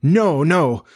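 high-pitched pleading refusal; frightened cry. A narrator's reading. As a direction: cry out cowering before the captain.

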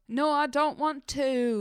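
a hammed-up fake British accent, refusing; terrible British accent. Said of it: "terrible British accent".

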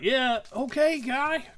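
lightly voiced lazy actor; mock wooden actor. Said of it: "He's like".

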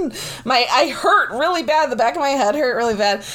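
ordinary talk, nothing marked

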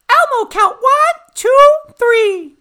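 high, slow Elmo squeak; squeaky furry monster counting. Retold or imitated. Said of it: "Elmo's the one who's like".

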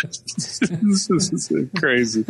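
slow words through laughter; laughing. Real, not acted. Laughing at the mortar story.

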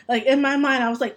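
normal talking voice

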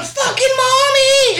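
high, drawn-out shout; yelling toddler. Retold or imitated. Mom imitating son wanting his pacifier, yelling. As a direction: imitate a small child hollering. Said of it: "So when he was yelling".

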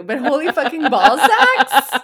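loud, high, laughing over the words; laughing disbelief. Real, not acted. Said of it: "That's just a really weird exclamation".

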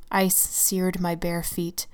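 plain reading voice

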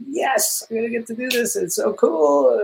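high, giddy yes, slowing down on so cool; thrilled to be back, voice jumping up; excited. Retold or imitated. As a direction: actor reliving joy at getting the sequel, high and giddy. Retelling own excitement at sequel.

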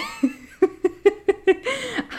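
high, loud giggling burst; burst of laughter. Real, not acted. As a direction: laugh out high at your own joke. Laughing at own joke.

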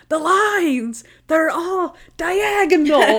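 high, drawn-out mock distress; hammed-up loud complaint; imitated freak-out. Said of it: "and Monk is like".

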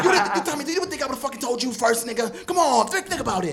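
high loud hammed-up scolding; laughing while scolding up high; imitating Ghost scolding Tommy; mock Ghost.

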